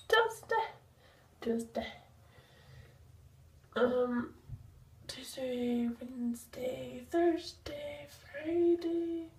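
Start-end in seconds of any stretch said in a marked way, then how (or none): 0.1-0.7 word squeaked up high. Acted. Repeats word much higher. 5.1-7.0 whispering. Acted. Whispered day list.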